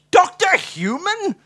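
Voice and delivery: high, loud, drawn-out HUMAN; amazed mishearing. A narrator's reading. Watson marvels at the name HUMAN, high and astonished.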